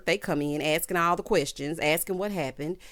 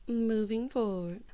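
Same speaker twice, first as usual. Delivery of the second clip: slow, softer segue; drawn-out transition; 5 dB quieter, slower than usual. Real, not acted. Host moving the recap to the next scene, drawn-out segue. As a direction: stretch it out softly to change the subject. Recap host's catchphrase between segments.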